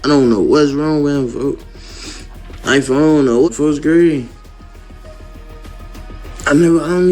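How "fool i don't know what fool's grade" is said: normal talking voice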